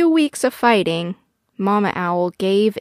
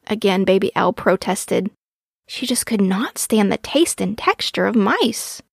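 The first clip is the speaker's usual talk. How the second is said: ordinary delivery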